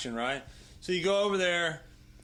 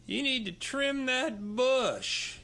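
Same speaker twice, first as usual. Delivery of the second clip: higher, slow teasing voice as Rita; mock Rita; 8 semitones higher, much slower than usual. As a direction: tease him about his bush, high and drawn out. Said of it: "and Rita goes".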